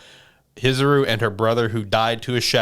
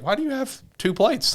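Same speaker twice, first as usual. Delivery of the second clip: suspicious sister. Retold or imitated. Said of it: "Mio's just like".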